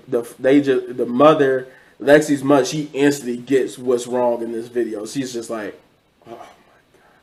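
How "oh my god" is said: imitating a hushed, horrified whisper; voiceless retold gasp of disbelief; stunned whisper. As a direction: whisper it as dawning realization. Retold mother's stunned reaction to video.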